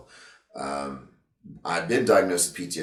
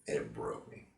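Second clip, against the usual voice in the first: emotional; 4 semitones lower, 8 dB quieter than usual. Real, not acted. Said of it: "I'm getting emotional thinking about it".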